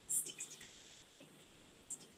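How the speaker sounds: whispered playful chant. Real, not acted. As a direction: breathe the words out, unvoiced. Whispered sound words.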